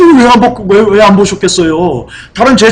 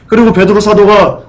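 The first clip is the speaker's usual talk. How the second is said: ordinary talk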